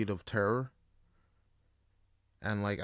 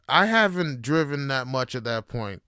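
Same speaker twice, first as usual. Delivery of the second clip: normal talking voice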